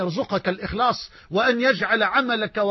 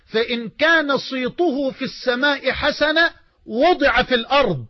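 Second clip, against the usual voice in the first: fervent hadith recitation; 4 semitones higher, slower than usual. Acted. Preacher reciting the Prophet's words to the congregation, slow and fervent. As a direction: proclaim each phrase slowly with mounting fervour.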